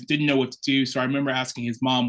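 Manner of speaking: usual speaking voice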